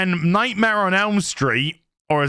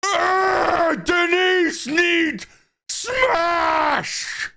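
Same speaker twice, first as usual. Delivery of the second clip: the drunk date yelling at Jimmy, a high mock shout; mock drunken shout; 11 semitones higher, much slower than usual. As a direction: bellow it like a tipsy woman begging for it. Drunk Denise voiced in story.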